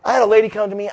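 normal talking voice

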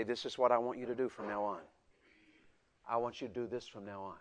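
low, soft fatherly instruction; gentle parent. Shown as a demonstration. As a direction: demonstrate calmly guiding a small child. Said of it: "sit your seven-year-old down".

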